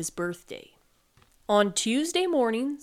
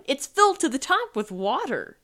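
raised, higher-pitched exclamation; shouting; 5 semitones higher, 4 dB louder than usual. A narrator's reading. Sloth finds the soup full of water, shouting. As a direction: raise your voice in dismay. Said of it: "he shouted".

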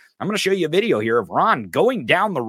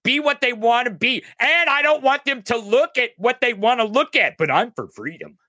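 mocking quote; 9 semitones higher than usual. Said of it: "You're like".

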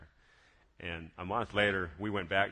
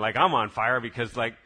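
ordinary talk

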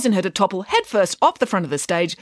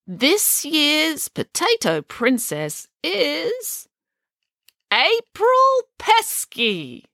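very slow, high announcer build-up; grand drawn-out reveal of the winner; suspenseful announcement; 11 semitones higher, much slower than usual. A narrator's reading. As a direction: draw out every word for suspense, rising higher.

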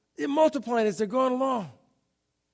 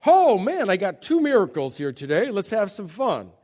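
mock excited showman; 7 dB louder than usual. Preacher mimics a careless Jesus, mock showman glee. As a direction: toss it off like a party trick.

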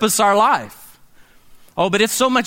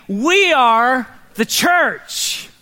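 very slow, each word weighted; slow emphatic declaration; 3 semitones higher, much slower than usual. Shown as a demonstration. Pastor leading congregation, drawn-out chant. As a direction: lead the crowd word by word. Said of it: "Say it with me".

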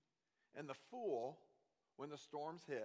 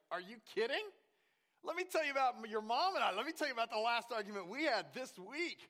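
high, loud disbelief; incredulous high exclaim; 8 semitones higher, 5 dB louder, faster than usual. Retold or imitated. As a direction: burst out in amazed disbelief. Dad retelling a fight, incredulous.

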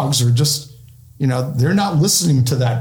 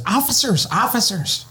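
put-on high calling old lady voice; a mock old lady calling officers; 6 semitones higher than usual. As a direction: call out like a frail old lady. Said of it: "an old lady says".

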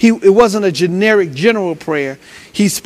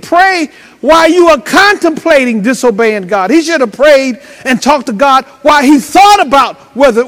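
raised, emphatic preacher delivery; fervent preaching; 4 semitones higher, 5 dB louder than usual. Acted. Preacher warns the congregation about the devil's lie, fervent preaching. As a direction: punch the key words to the congregation.

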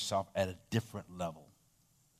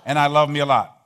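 emphatic recital; 9 dB louder than usual. Shown as a demonstration. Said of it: "Say this".

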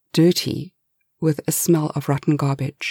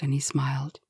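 ordinary delivery, nothing marked

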